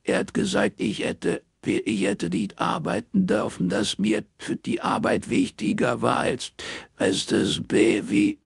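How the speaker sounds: high, broken crying voice; distraught, halting and tearful; sobbing stammer. A narrator's reading. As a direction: force the words out between sobs. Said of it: "zerschniefen, schlucken und hexen".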